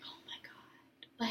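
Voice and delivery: breathless whispered oh my God; barely voiced, slow and amazed; awed whisper. Telling her boyfriend about the hug, a hushed awed whisper. Inner thought during the long hug.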